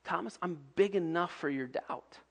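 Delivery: soft, gentle reassurance; Jesus softly reassuring doubting Thomas; tender Jesus. Pretend. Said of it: "But to say".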